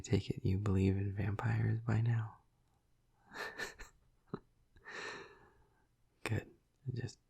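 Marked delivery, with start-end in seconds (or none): 3.3-3.8 quiet, voiceless chuckle; soft breathy laugh. Acted. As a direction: laugh softly under the breath. Laughs at own question.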